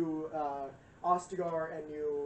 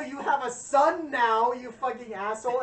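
loud, high ranting wife; hammed-up yell at a runaway husband; mock angry wife; 5 semitones higher, 9 dB louder than usual. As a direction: chew him out as the furious wife. Wife yelling at Oghren, retold.

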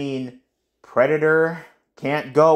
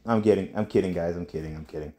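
trailing off softer each time; fading to quiet; 11 semitones lower, 7 dB quieter, faster than usual. Real, not acted. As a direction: let it die away quietly. Teasing then fading repeats.

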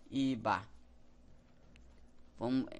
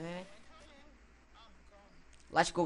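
normal talking voice

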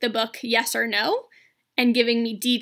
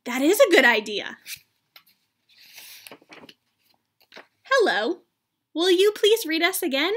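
lively, high character voices for a picture book; two story characters; 7 semitones higher, 5 dB louder, slower than usual. Acted. Teacher voicing Gerald and Piggie for students. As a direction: act out Gerald and Piggie for the class. Reads Elephant and Piggie aloud.

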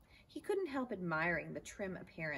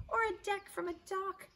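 much higher, louder scream; 5 dB louder than usual. Acted. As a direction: squeal it out at the top of the pitch. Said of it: "screamed Stuart".

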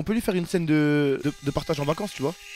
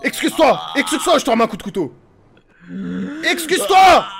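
loud, raised-pitch acted shouting; furious demand; 5 semitones higher, 7 dB louder, faster than usual. Roleplayer demanding apology at knifepoint, furious shouting. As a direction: yell the threat, building to a higher scream.

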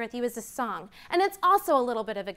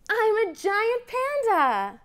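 higher, bright announcing voice; excited reveal; 10 semitones higher, 3 dB louder than usual. Acted. Teacher revealing the answer to children, excited. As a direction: proclaim the answer like a prize.

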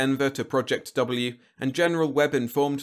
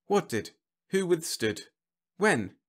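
high-pitched, bewildered questions; confused; 8 semitones higher than usual. A narrator's reading. Whitlow baffled by the general's account, confused. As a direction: fire off lost little questions. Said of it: "asked Whitlow with much confusion".

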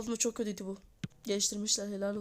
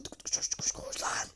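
hushed urgent run run run; whispered urging; 4 dB quieter than usual. Real, not acted. Gamer whispering at his player to run.

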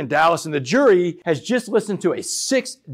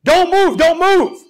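high, very loud shouted command; top-of-lungs scream; 12 semitones higher, 9 dB louder, slower than usual. Retold or imitated. SWAT cop standing over him, screaming at top of lungs. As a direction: scream the order full out. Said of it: "he is screaming at the top of his lungs".

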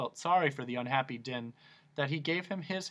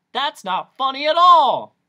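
much higher, much louder wail; hammed-up blubbering protest; loud mock sob; sobbing; 12 semitones higher, 15 dB louder than usual. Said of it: "sobbed the Din".

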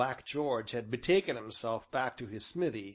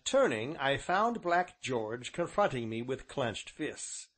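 ordinary delivery, nothing marked

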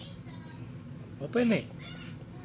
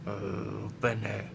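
quiet; 11 semitones lower, 7 dB quieter than usual. Shown as a demonstration. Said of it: "repeated request, different take".

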